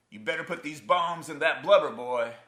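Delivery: high, loud bullying order; father's shouted threat. Acted. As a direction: holler it at a son who won't obey.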